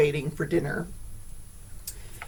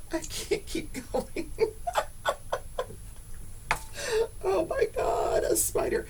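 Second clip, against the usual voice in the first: high, helpless laughter; cry-laughing, can't stop; laughing fit; 6 dB quieter than usual. Real, not acted. Laughing fit after spider.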